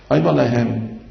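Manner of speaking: usual speaking voice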